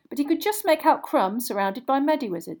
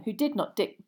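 plain reading voice

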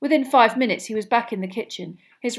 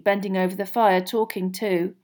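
ordinary delivery

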